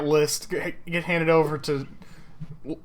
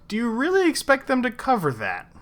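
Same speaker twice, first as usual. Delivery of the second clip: higher-pitched incredulous challenge; 6 semitones higher than usual. Acted. Kat challenging Shade over the giant list, incredulous. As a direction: question it in raised-pitch disbelief.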